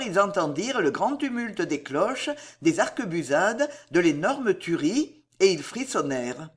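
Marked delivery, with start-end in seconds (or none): none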